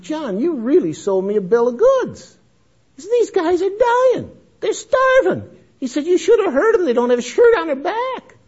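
high and loud, the retold pastor is shaken and pleading; alarmed pastor. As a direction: voice the upset pastor high and loud, slowing on the starving.

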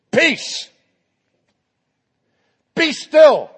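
Peace, be still called out loud and high; commanding shout. Pretend. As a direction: order the wind and sea to stop. Jesus commands the storm.

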